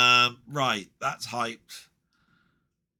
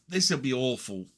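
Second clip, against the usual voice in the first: usual speaking voice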